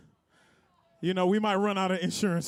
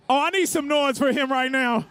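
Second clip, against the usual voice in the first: hype announcer; 4 semitones higher than usual. Acted. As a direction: fire up the crowd. Hype host on the mic.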